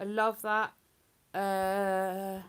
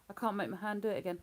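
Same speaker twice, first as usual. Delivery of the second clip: ordinary talk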